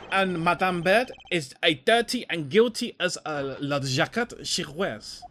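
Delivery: lightly put-on French accent, slowing at the end. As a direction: sneer it like a haughty Frenchman. French-voiced accusation.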